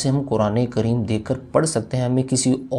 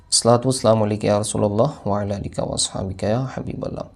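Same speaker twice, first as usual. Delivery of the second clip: devotional recitation; 3 semitones lower, 4 dB quieter than usual. Shown as a demonstration. Recites a salutation.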